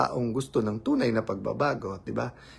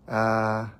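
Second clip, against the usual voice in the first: ordinary talk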